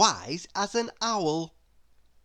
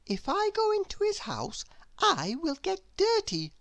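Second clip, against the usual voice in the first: high musing voice; 14 semitones higher than usual. A narrator's reading. Little Miss Wise thinking about Mister Messy's house, high and fretful. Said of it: "she said to herself".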